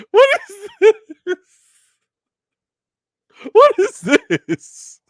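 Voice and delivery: very high, loud, stretched shrieks; high-pitched what is this; shrieking disbelief. Real, not acted. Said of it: "shocked reaction to the kiss".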